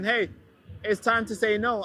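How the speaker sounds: usual speaking voice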